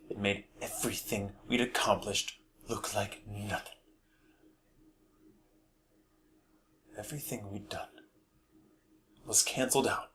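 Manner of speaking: hushed confession with long, heavy pauses; very soft, sinking almost to a whisper; Dodge. Acted. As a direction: confess a dark family secret quietly. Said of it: "I'll be performing the role of Dodge".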